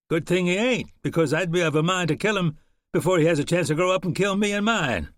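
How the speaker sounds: lightly voiced callous frontiersman; cold volunteer. Volunteer talking of killing the boy, cold.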